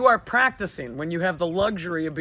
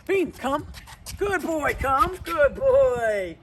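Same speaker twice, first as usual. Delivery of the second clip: higher happy call and praise for the dog; cheerful recall; 5 semitones higher, much slower than usual. Real, not acted. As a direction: sound like his biggest fan. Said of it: "here's how we normally do it".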